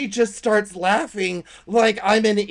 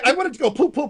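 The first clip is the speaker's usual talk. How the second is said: put-on baby talk; 7 semitones higher, 3 dB louder, much faster than usual. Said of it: "and I'm like".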